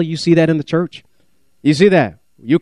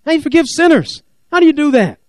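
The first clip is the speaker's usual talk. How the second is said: indignant Pharisees; 8 semitones higher than usual. Retold or imitated.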